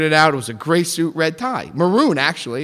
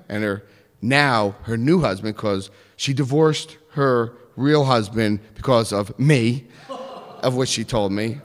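normal talking voice

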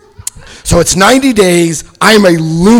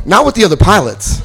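ordinary talk, nothing marked

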